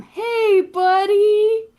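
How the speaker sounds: very high, loud mocking call; teasing hello. Dam teasing Eld as he enters the tavern, loud mocking call. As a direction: rib your blushing friend.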